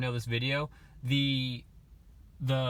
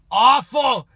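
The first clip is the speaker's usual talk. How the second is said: loud exclaim; 9 semitones higher, 12 dB louder than usual. Real, not acted. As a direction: burst out loud on awful. Self-mocking about first practice.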